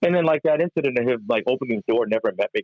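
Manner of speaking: ordinary talk, nothing marked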